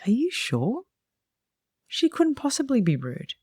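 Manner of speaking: high-pitched, incredulous questioning; retold disbelief. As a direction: quote yourself doubting it, pitch lifted well up. Disbelieving reply to a rep's complaint.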